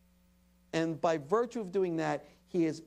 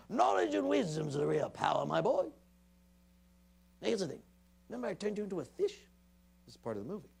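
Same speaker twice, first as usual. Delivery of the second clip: a lightly voiced old wizard imitating Owen, higher at the end; Merlin's voice; 4 semitones higher than usual. Father imitating his son voicing the wizard mentor, lightly done. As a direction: gently play a kindly wise old wizard advising a boy. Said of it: "And in Merlin's voice".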